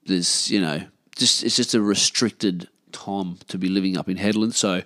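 ordinary talk

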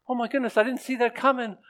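high-pitched pretend shock; hammed-up caught-off-guard alarm; mock freak-out. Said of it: "God is not freaking out".

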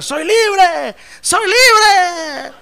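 high, drawn-out mock scream; loud, hammed-up wail of joy; chicken's cry of freedom. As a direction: yell it like a chick carried off in the air. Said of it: "empezó a gritar".

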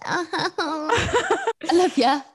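giggling through the words, very slow and high; laughing. Real, not acted. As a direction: laugh while saying it fondly. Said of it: "I love you too, baby".

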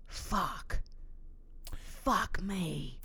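hushed, near-whispered swearing; dismayed mutter. Acted. Jamie reading his wife's messages, hushed dismay. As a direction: curse softly under the breath in dismay.

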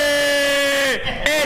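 loud high cheer; excited cheer. Real, not acted. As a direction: cheer the finish. Comemora o fim. (comemoração animada)

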